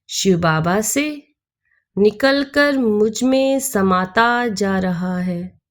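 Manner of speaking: unhurried meditation voice with long pauses; guided meditation. Acted. Teacher leading listeners into soul-meditation with Shiv Baba, calm guided meditation. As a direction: guide them inward phrase by phrase, letting each settle.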